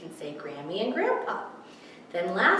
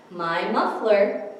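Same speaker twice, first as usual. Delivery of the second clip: slow, louder read-aloud; reading to children; 5 dB louder, slower than usual. Acted. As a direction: read it out slowly for toddlers. Reading picture book aloud.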